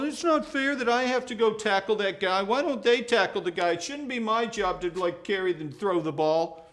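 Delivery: high, loud complaining whine; mock a sulking player; hammed-up whining athlete; whiny player.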